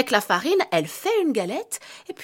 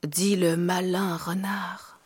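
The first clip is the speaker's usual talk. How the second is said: whispering; 7 semitones lower, 6 dB quieter, slower than usual. A narrator's reading. Whispered narration.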